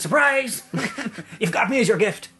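lightly put-on Irish accent; a hint of Irish lilt, high and excited. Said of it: "in his Irish accent".

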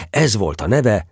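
ordinary delivery, nothing marked

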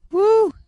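high, loud whistle-like "whew"; whistled whew. A narrator's reading. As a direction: let out a high, loud whoop of astonishment. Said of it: "whistled Cupid".

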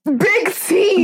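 high, far-carrying mock holler; mock calling out. Playfully warning a rival off, mock calling out. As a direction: call the name out high, in play.

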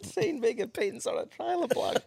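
high, soft, laughing repeat; real laugh breaking into the line. Real, not acted. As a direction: squeeze the words out through laughter. Cracking up repeating the comment.

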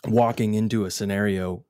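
usual speaking voice

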